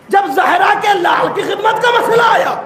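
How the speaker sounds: pleading shout; impassioned plea. Acted. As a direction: cry it out as a wronged servant. Slave pleads with master, rising.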